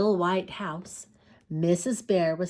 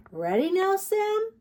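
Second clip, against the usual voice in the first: higher, sing-song mama voice; gentle mother bear; 12 semitones higher, 3 dB louder than usual. Acted. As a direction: coax the cub toward sleep. Mother bear asks again.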